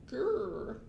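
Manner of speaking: mock troll growl.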